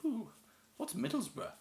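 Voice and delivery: high, mocking posh schoolkid voice; posh kid mock. A posh classmate sneering at his team, high mock voice. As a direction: put on a snooty southern kid asking. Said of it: "I went to quite a posh school as well".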